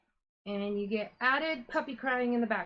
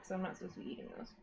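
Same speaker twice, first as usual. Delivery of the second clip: fast, softer, guilty whisper; quick whispered confession; whispered aside; 4 semitones lower, 9 dB quieter, much faster than usual. Real, not acted. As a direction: mutter it behind your hand. Guilty aside about sweets.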